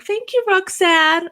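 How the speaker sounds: high, slow, sugary thank-you from a tipper; sweet thank-you. Retold or imitated. Viewers thanking the cam girl, in a high slow coo. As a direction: coo the name like a happy viewer.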